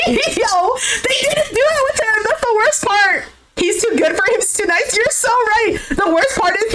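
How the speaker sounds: high, laughing through the words; can't stop laughing while ranting; squealing with real laughter; laughing hard. Real, not acted. Cracking up at the failed cheer.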